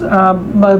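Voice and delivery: ordinary talk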